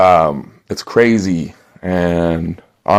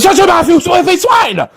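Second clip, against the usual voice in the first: furious German shout. Acted. Salesman snaps at customer.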